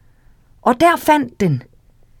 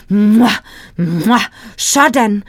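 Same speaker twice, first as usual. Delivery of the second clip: lip smacking; slower than usual. Acted. Whale smacks its lips.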